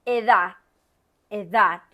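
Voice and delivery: edad said loud with stress on the last a and no D; teacher models edad; loud, final d dropped. Shown as a demonstration. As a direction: say edad as eDA, final d silent. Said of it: "Es lo mismo de necesidad".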